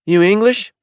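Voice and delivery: a high, curious shopkeeper asking; shop woman's voice. A narrator's reading. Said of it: "she asked".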